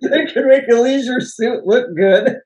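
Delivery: words riding on a laugh; amused, laughing. Real, not acted. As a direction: laugh while getting the joke out. Joking about Paul Newman's suit.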